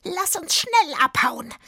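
high, loud, croaky witch; smoky croaking witch. A narrator's reading. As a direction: croak an urgent order as a witch. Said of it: "mit ihrer rauchig krächzenden Stimme".

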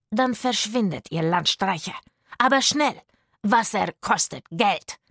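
loud, gruff dismissal; angry guard. Acted. Guard driving the thirsty children away, loud and rough. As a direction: shoo the beggars off.

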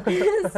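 short loud laugh. Acted. Girl laughing at the painter, short and loud. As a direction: burst out laughing.

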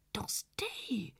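breathless whispered name; gasped whisper. A narrator's reading. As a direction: gasp the name in shock. Else erschrickt flüsternd. (erschrocken geflüsterter Name)

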